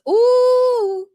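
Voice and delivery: a high, loud ooh of amazement; surprised ooh. Real, not acted. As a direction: gasp it out at the news. Reacts to five sons.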